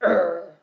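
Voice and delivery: short, low play growl; grumpy growl. Pretend. Storyteller acts out the woken lion, grumpy growl. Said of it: "was he grumpy?".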